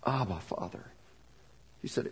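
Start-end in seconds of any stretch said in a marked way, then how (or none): 0.1-0.9 soft, low and hushed, with more feeling than before; tender, hushed plea. Shown as a demonstration. Preacher showing the emotion of Jesus facing death, hushed. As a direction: whisper it like a son facing death. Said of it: "Try to capture the emotion".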